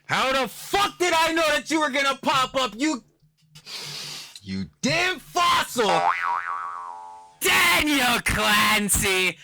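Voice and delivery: high, hammed-up furious yell; drawn-out, over-the-top villain-cursing shout; mock outrage. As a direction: cry out in theatrical fury at an arch-nemesis. Mock outrage at Dan's pop-up.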